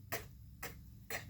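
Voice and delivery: "K, k, k." as a bare breathy k sound; hard k sound. Shown as a demonstration. Teaching a child the C sound in "K, k, k.". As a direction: clip "k" to just the sound, said three times. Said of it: "start with this sound".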